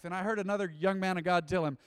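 usual speaking voice

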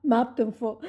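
usual speaking voice

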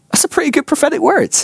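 high, mock-impressed surprise. Teacher hearing a teenager's word about a juggling ball, high and impressed. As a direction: sound pleasantly amazed.

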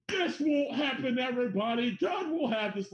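hammed-up faithful believer; mock preacher voice. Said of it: "Everybody's like".

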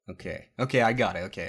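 ordinary talk, nothing marked

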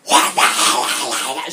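forceful voiceless shout; whisper-yell. Shown as a demonstration. Co-host asked to try whisper-yelling, hissed whisper shout. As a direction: yell it with no voice, only breath. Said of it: "try and whisper yell for me".

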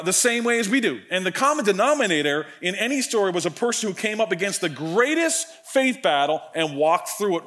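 ordinary talk

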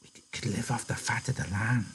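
said softly. Acted. Lenny dreaming aloud to George, softly. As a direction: breathe it out like a secret dream. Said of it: "he said softly".

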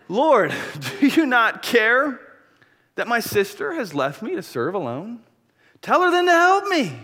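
high, loud, drawn-out protest as Martha; exasperated complaint. Acted. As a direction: act out an aggrieved sister demanding help. Acting out Martha's complaint to Jesus.

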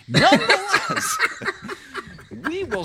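laughing. Real, not acted. Laughing through spoilers joke.

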